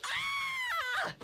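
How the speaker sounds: very high shouted scream. Acted. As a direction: scream in terror.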